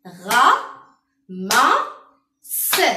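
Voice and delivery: "Ra, ma, se" loud and separated; each of "Ra, ma, se" its own strong beat; three beaten syllables. Shown as a demonstration. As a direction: punch out "Ra... ma... se" one by one. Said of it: "on dit trois syllabes".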